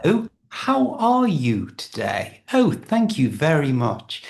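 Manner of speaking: hello in textbook RP English; RP accent demo. Shown as a demonstration. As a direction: put on a polished RP English voice. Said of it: "That's sort of an R P accent".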